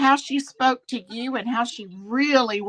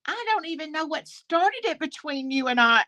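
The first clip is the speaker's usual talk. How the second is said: southern drawl; 5 semitones higher than usual. Retold or imitated. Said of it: "in her southern drawl".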